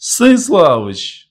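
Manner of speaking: high, loud, drawn-out call; shouting. A narrator's reading. Long man spots Kozlov at the door, shouting. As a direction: cry out the name in greeting. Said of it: "закричал Длинный".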